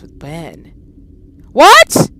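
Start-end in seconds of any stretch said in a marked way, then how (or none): 1.5-2.1 sudden high shocked "What?"; startled what. Real, not acted. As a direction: jump at the sight. Startled reaction while playing.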